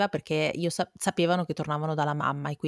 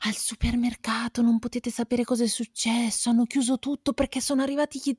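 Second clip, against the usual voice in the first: dramatic mother; 8 semitones higher than usual. Retold or imitated. Mother's scary supermarket tale.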